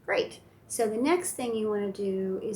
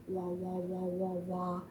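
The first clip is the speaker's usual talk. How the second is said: soft fishy wah wah; fish sound; 2 semitones lower, 5 dB quieter, slower than usual. Shown as a demonstration. Showing a toddler a fish mouth sound, soft wahs. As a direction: pop your lips like a fish. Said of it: "like a fish sound".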